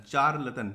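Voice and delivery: चार लतन said "chaar-latan", hard "ch"; read as spelt. Shown as a demonstration. Teacher repeating it for spelling, read as spelt. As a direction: sound out चार लतन from the letters. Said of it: "इस तरीके से पढ़ें".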